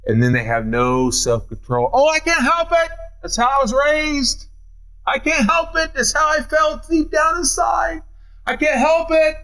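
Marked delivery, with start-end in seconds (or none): 1.9-9.4 high, whining mock excuse; high whiny imitation of people making excuses; whiny excuse maker. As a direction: mimic a whiny person who won't take blame. Mimics people excusing themselves.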